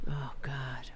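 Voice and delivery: breathy whisper of dismay; whispered oh God. Real, not acted. To herself after the rant, whispered. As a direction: whisper it in tired disbelief. Exasperated aside.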